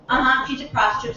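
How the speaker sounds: normal talking voice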